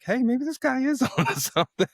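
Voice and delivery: high, put-on voice of a doubter coming round; mock convinced skeptic. Chef imitating skeptics who now believe him, high mock voice. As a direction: pitch it way up like the doubters finally admitting it. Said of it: "people were like".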